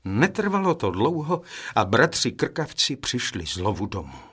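usual speaking voice

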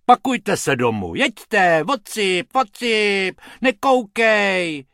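loud, high, irate orders; yelling to clear off, stretching the last word; angry shooing. A narrator's reading. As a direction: send them packing at full volume. Hrubec chases the rabbits away.

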